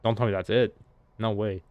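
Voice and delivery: normal talking voice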